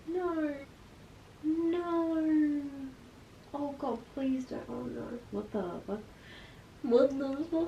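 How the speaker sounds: can't believe what she's reading; real hushed shock; stunned disbelief. Real, not acted. Reading the twist on camera.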